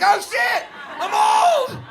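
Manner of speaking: very high, loud panicked cry; loud high shocked yell; horrified shout. Retold or imitated. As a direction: shriek the realization. Realizing he's old.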